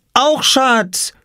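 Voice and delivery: put-on very high, excited little-child call; toddler calling out. A narrator's reading. Little Lars running after Papa, a very high toddler call. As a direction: call out like a tiny boy who just learned a word. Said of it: "rief er".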